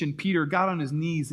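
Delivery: ordinary talk, nothing marked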